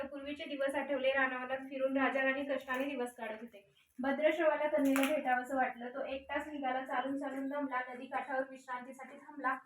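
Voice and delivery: measured traditional folk-tale telling; devotional story narration. A narrator's reading. Narrator telling listeners Lakshmi's vrat story, rhythmic storytelling. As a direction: tell the sacred tale in short rhythmic phrases.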